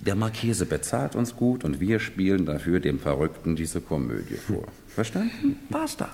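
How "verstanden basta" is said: curt dismissal. Acted. Servant ends the argument.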